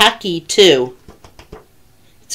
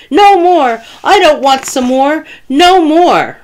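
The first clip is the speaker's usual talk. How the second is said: high, loud panicked bear; terrified cry; 9 semitones higher, 5 dB louder than usual. A narrator's reading. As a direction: cry out in terror, pleading to stop. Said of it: "the terrified bear cried out".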